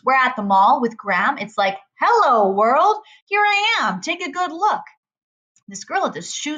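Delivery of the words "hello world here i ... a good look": hammed-up show-off cry; mock showing off. A narrator's reading. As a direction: proclaim it to the whole mall.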